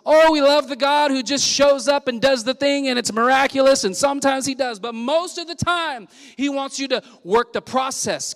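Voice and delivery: high, loud preacher's build; preaching at full force. Acted. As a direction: lift the room, preaching hard.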